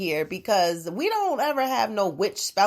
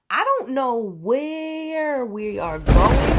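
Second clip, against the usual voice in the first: drawn-out dramatic; 7 semitones higher, 4 dB louder, much slower than usual. Acted. Dramatic musing on life.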